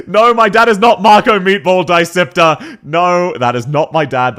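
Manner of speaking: loud, high-pitched denial; loud protest. Real, not acted. Streamer denying a chatter's claim about their dad, loud protest. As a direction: raise your voice and insist.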